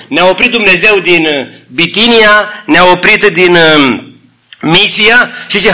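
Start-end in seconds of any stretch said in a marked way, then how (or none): none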